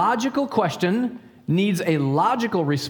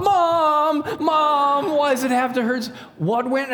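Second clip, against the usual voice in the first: mock screaming child; 10 semitones higher, slower than usual. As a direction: imitate a hurt boy calling out. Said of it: "I hear my son screaming".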